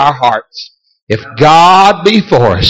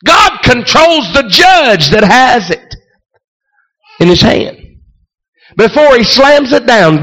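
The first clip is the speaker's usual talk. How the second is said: fervent preaching; 7 semitones higher than usual. Acted. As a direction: preach it out with fire. Preaching at full force, high pitch.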